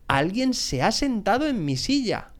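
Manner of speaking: high-pitched, mildly indignant papa bear; mock growl. A narrator's reading. Papa bear finding his chair used, mildly growled. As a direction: grumble it lightly, pitched high, falling at the end. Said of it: "gruñó el oso papá".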